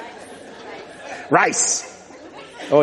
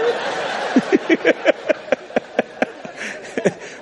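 high, breathless wheezing laugh; 11 semitones higher, 3 dB quieter than usual. Real, not acted. Preacher laughs at his own baby joke, high and breathless.

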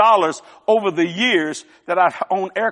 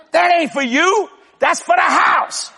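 high, raised-voice punchline; 7 semitones higher than usual. Real, not acted. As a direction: exclaim it high, slowing on the second half. Mock protest over practical gifts.

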